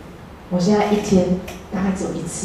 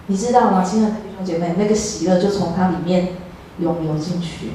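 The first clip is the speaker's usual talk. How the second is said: usual speaking voice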